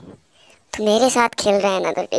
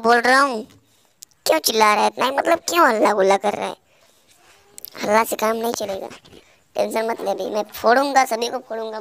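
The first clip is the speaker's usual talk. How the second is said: ordinary delivery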